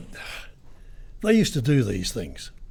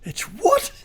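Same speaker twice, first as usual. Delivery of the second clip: shrill, loud leap up in shock; 5 dB louder than usual. Retold or imitated. Car owner hearing his car fell off the hoist, shocked high yelp. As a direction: squeal it out in disbelief. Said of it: "very upset".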